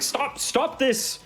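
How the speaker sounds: high, slow call from afar; distant commanding voice. Acted. Captain runs after the fighters calling them off. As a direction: call out while running up. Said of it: "It's like".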